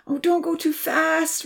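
high, slow fearful wail. A narrator's reading. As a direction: beg her not to speed in fright. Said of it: "Rosa wailed".

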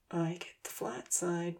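slow, soft whisper. A narrator's reading. Ponzi whispering his claim to Odin, slow and soft. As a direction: hiss it under your breath. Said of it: "Ponzi whispered".

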